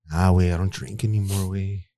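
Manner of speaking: Albie's way of talking. Retold or imitated. Telling his friend why he quit drinking, lightly imitated buddy voice. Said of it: "he talks like that".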